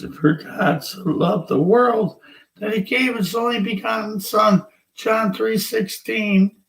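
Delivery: mocking churchgoer recital. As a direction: parody a friend reciting John 3:16 by rote. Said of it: "Just recite a verse".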